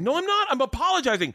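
very high, squealed indignant denial. Acted. Wife insists to husband she is apologizing, shrieky and defensive. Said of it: "Now you're raising your voice".

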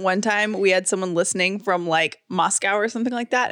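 normal talking voice

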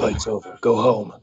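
mock superhero voice, pitched well down; deep, put-on vigilante voice; Batman voice. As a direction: drop low for a playful hero impression. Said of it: "Did you say in Batman voice?".

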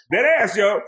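ordinary delivery

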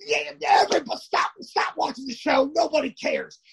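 high mock outrage, rising to a shout; sarcastic fuss. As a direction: lay the fake indignation on thick. Mocking Jamie's overblown fuss.

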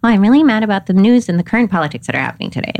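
fast, talky rundown from the head; mock over-explaining anger at the news; rattled-off heady complaint. Shown as a demonstration. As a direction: rush it like an intellectual report. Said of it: "versus going".

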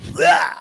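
loud aggressive roar imitation; loud goose roar. Imitates the geese puffing up, a loud roar. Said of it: "they both just went".

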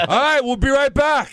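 high, bright-pitched sign-off; mock TV announcer. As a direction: throw to the break in a parody host voice. Mimics host throwing to commercial break.

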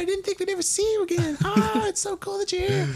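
put-on very high, gushing children's voices; excited kids. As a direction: squeal like starstruck kids. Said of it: "They're like".

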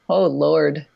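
usual speaking voice

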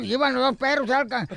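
ordinary talk, nothing marked

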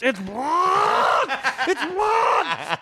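very high laughing mock yell. The maid in the hallway hears a laughing high mock yell. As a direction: yell it again, cracking up. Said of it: "she hears".

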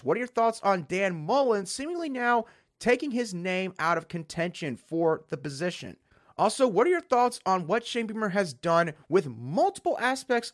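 upbeat host sign-off; podcast outro. Acted. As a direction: wrap up the show like a host.

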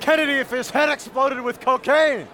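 high, excited fan outburst; starstruck gushing. Acted. Fan meets Kimberly Guilfoyle at convention, starstruck gushing. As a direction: gush at your idol, pitched right up.

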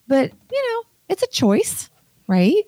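high-pitched sarcastic concession. Real, not acted. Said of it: "You can hear the sarcasm in my voice".

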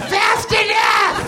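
falsetto yell, dragged out slowly; hammed-up squeaky cop answer; slow high-pitched shout. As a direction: screech fast enough slowly and high. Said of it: "I was going".

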